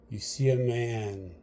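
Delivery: ordinary talk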